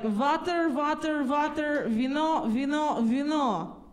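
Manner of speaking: heavy Russian "vater, vater, vino"; Russian accent. Retold or imitated. Comic quoting father ordering drinks, heavy Russian accent. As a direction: bark it like a Russian dad ordering. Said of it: "And he's like".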